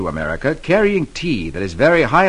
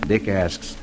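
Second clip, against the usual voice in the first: usual speaking voice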